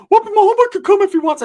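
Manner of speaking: very high, loud cousin voice; goofy high-pitched imitation; Ricky. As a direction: plead in an exaggerated squeal. Ricky pleads for his friend.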